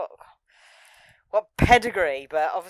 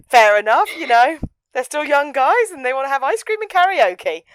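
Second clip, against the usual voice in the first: amused shrug; 6 semitones higher, 10 dB louder than usual. Retold or imitated. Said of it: "And I'm like".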